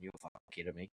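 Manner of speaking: quiet exasperated aside; under breath. Retold or imitated. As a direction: grumble it to yourself, barely voiced. Watching workmates fail at cutting.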